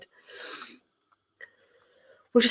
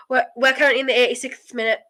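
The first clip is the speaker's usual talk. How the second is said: ordinary talk, nothing marked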